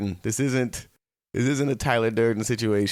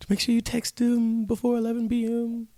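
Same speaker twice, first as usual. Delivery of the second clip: high mock warning; 11 semitones higher than usual. End of the horror bit.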